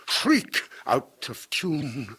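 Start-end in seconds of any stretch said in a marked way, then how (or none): 0.1-0.6 shrieked word. A narrator's reading. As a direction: shriek sung out much higher. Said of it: "They can only shriek".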